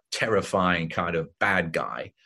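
ordinary talk

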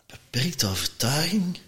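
soft puzzled repeat, pitch up a little; baffled echo. Retold or imitated. To Mark on the terrace, a soft baffled echo. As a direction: repeat the phrase as if it's nonsense.

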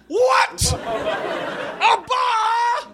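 put-on squeaky shouting at a baby; high yelling driver voice; high-pitched shouting cabbie. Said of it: "replay that conversation".

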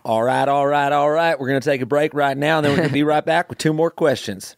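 folksy Southern accent. Shown as a demonstration. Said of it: "let's do, like, Southern accent style".

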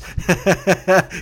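short, high chuckling burst; burst of laughter. Real, not acted. Laughing about yelling at a kid who slammed his door, amused cackle. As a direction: crack up at your own story.

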